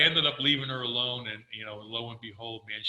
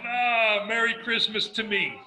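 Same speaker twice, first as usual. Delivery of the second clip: higher and louder joyful exclamation; overjoyed; 9 semitones higher, 7 dB louder, slower than usual. Retold or imitated. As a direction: burst out with delight. Reacting to pregnancy news.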